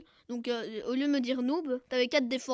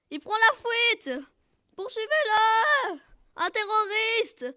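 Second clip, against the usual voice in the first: high, loud, slow rallying cry; hammed-up call to give chase; mock alarm; 7 semitones higher, 7 dB louder, much slower than usual. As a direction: raise the alarm with stretched shouts. Mock alarm as target flees.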